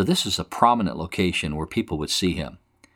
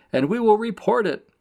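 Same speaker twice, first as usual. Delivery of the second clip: high-pitched jeering threat; mocking; 12 semitones higher, 3 dB louder than usual. Mockers threatening to report Jeremiah, mocking. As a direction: taunt him in a high voice. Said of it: "they're mocking him, saying".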